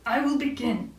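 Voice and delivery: lightly voiced owl character; a hint of an owl announcing his start; storybook Owl. A narrator's reading. Owl gets ready to begin, lightly voiced character. Owl readies himself to cry.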